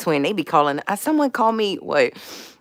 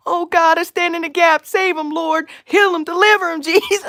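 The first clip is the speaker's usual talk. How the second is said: much higher, loud intercession; imitating pleading prayer; 12 semitones higher, 4 dB louder than usual. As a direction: plead for them in a high voice. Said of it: "And then I'm like".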